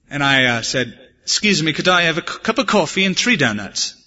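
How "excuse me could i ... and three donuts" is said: attempted English accent, lightly pitched up. Preacher ordering to fool the shop owner, English accent. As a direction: put on a polite English accent. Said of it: "I do a pretty mean English accent".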